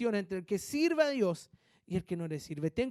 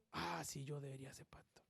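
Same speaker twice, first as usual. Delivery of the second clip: whisper; 6 semitones lower, 14 dB quieter than usual. Retold or imitated. Flattered believer's inner voice.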